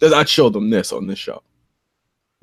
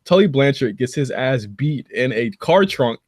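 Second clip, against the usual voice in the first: ordinary delivery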